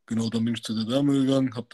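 normal talking voice

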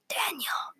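high, whispery call of the name; hushed call. A narrator's reading. Unknown voice calls to collapsed Daniel, hushed call. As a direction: call very softly, almost all breath. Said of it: "louder this time".

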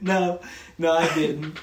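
laughing denial. Retold or imitated. Student denies insult to teacher, laughing. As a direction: deny it while cracking up. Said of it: "I was like".

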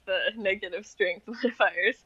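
ordinary talk, nothing marked

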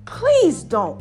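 normal talking voice

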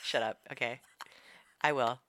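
ordinary talk

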